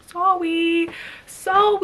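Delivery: lightly acted guilty sister; mock sheepish apology. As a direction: fake a meek little apology. Apologizing to brother in retelling.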